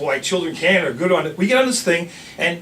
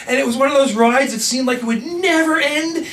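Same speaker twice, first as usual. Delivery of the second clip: usual speaking voice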